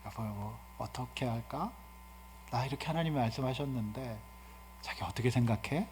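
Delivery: gentle soft asking. Shown as a demonstration. Gentle husband asking his wife's opinion, soft. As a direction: ask her kindly and quietly. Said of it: "이게 아니라".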